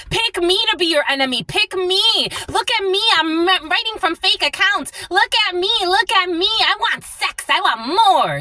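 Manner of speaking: high-pitched attention-seeker, hammed up; mock needy pick-me squeal. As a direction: whine it high, begging to be noticed. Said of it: "They're a pick me".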